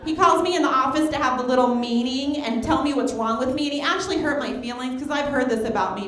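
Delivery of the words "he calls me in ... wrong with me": usual speaking voice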